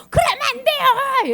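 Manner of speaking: high, loud, stretched plea; frantic protest. Acted. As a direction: wail a hammed-up objection. Peter protests against Jesus dying.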